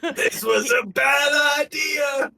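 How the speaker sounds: high, slow wail of regret; mock wail. Captive being hauled away by pizza crowd, mock wail. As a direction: wail it out in comic dismay. Player's character dragged off, protesting.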